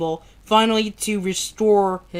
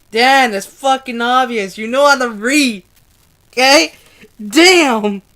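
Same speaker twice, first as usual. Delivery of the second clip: frustrated; 3 semitones higher, 7 dB louder than usual. Real, not acted. Scolding himself for misreading.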